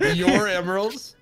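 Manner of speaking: high, loud mock-doubtful "your emeralds?"; exaggerated incredulity. Shown as a demonstration. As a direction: ham up the suspicion, pitch up. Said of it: "You said that like they weren't my emeralds".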